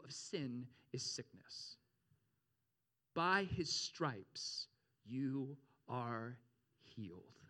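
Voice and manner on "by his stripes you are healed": grave, drawn-out verse; solemn scripture. Real, not acted. Solemn scripture climax, word by word.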